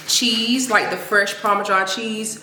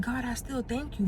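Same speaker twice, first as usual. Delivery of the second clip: whispered prayer; 3 semitones higher, 9 dB quieter than usual. Real, not acted. Prayerful aside to God.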